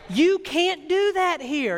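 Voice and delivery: "can't do that here" drawn out, high-pitched and emphatic; emphatic insistence. Real, not acted. Pastor insists to congregation, "you can't do that here" stressed emphatically.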